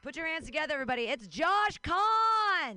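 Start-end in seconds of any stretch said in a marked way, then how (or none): 1.0-2.8 drawn-out, higher, louder name call; announcer call. Real, not acted. Host bringing up the next comic, with a long loud call. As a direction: stretch the name out for the crowd.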